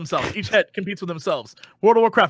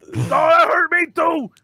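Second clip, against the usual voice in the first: higher, slow wailing ogre; hammed-up hurt ogre wail; dim giant; 8 semitones higher, slower than usual. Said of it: "amazing voice acting right there".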